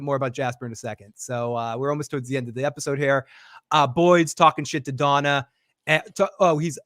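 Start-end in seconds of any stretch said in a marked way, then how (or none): none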